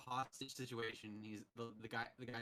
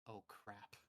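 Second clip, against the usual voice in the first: much softer, lower dread mutter; Mysterio; 5 semitones lower, 12 dB quieter than usual. Retold or imitated. Mysterio realizing he's doomed, soft dread. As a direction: say it like you just realized you lost. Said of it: "Mysterio is the first to go like".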